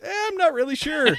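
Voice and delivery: high clueless voice. Retold or imitated. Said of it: "he's like".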